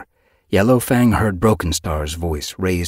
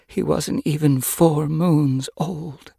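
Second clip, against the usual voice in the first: high, slow lament; grieving; 10 semitones higher, slower than usual. A narrator's reading. Yellowfang carrying the young body home, slow grief. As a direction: lament it slowly, heart wailing.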